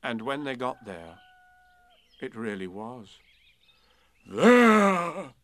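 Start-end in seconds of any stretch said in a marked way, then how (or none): 4.2-5.3 proud. A narrator's reading. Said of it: "said Eeyore proudly".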